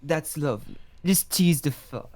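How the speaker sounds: put-on grand British gentleman greeting, British accent; potion-made English gent hails a lady, British accent; posh British accent. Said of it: "turn British again".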